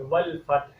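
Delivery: والفتح ending in an audible h; clear final h. Shown as a demonstration. Teacher isolates the final h of والفتح. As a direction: breathe out the h at the end of والفتح. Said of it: "ہا کی آواز آنی چاہیے،".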